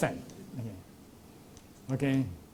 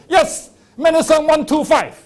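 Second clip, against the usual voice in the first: snake oil seller; 9 semitones higher, 7 dB louder than usual. Retold or imitated. Quack finds medicine, gleeful.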